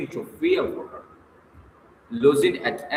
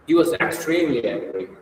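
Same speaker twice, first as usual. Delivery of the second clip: ordinary delivery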